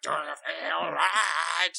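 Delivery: slow, stretched-out hammed-up "do you feel right"; light imitation of a stage call; mock rock singer. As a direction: croon it like a glam rock frontman. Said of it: "and Paul's like".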